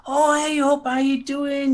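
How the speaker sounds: high, drawn-out sing-out hello; mock friendly greeting. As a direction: greet a pal at a stand, put on. Acts out greeting at expo stand.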